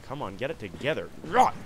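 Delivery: ordinary delivery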